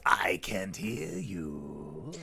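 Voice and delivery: slow whispered wrestler taunt; Hulk Hogan taunt. Retold or imitated. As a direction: ear cupped to the crowd. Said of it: "Hulk who did the".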